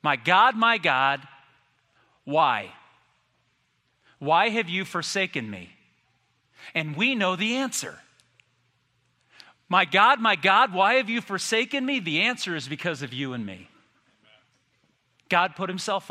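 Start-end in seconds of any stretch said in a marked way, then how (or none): 0.0-5.8 loud opening cry broken by long pauses. Acted. Jesus on the cross calls to his Father, crying out. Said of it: "cried out to his Father". 9.7-12.0 higher, louder, unbroken anguished cry. Acted. Jesus on the cross cries to his Father, crying out. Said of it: "cried out to his Father".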